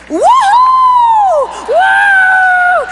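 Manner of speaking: long, high, loud woo-hoo; excited whoop. Acted. God opens the box and sees her, excited whooping. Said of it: "really excited".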